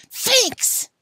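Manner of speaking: put-on high, loud yell of a furious boy; yelled at top of voice. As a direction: scream it at the window. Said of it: "he yelled at the top of his voice".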